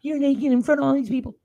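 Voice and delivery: preacher mocking his wife when she is angry, in a high mock voice. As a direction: squeak it out like a scandalised wife, mocking her. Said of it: "I'd be like".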